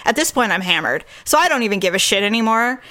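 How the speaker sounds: ordinary talk, nothing marked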